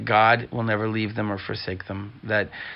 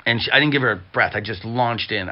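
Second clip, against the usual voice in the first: usual speaking voice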